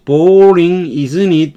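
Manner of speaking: "Boring" stressed, "isn't it?" falling; falling tag. Shown as a demonstration. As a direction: lean on "Boring", then drop. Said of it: "이렇게 내리는 거예요".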